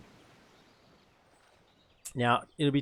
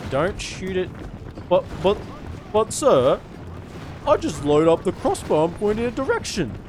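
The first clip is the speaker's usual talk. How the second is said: confused soldier voice; 7 semitones higher, 5 dB louder than usual. Acted. Confused soldier questioning his trainer.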